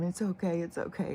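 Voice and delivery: calm the frightened dog; soothing. Retold or imitated. Soothing the dog while rubbing.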